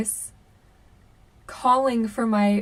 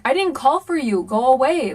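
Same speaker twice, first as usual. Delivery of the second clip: raised, louder exasperated retort; really annoyed; 3 semitones higher, 5 dB louder than usual. Retold or imitated. Sister yelling through shower door at brother, annoyed. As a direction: send him away, irritated. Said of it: "I got really annoyed".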